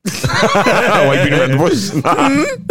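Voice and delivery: slow, high, laughing tease. Real, not acted. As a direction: tease him through laughter. Teasing a friend.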